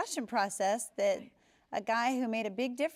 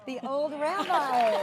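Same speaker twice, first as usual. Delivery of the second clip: slow, louder playful echo of the role; amused echo; 5 semitones higher, 7 dB louder, slower than usual. Real, not acted. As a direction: repeat it slow and delighted. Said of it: "laughs, right, of course".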